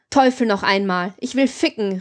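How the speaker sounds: ordinary delivery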